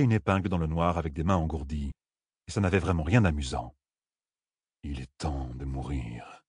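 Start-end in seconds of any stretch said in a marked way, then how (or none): 4.8-6.4 very soft, slow, low murmur. A narrator's reading. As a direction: murmur it slowly, far below your usual voice. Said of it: "murmura Loustérine". (murmure grave et lent)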